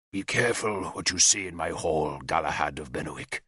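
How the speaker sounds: low, soft menacing growl; a quiet threat down the blade. A narrator's reading. King threatening Galahad at swordpoint, low growl. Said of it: "Gorfethid growled".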